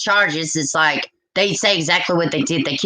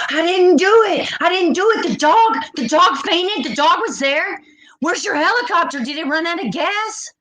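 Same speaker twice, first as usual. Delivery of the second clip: mocking suspect denial; 10 semitones higher than usual. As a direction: squeal it like a guilty man caught running. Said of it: "I can see his ass now".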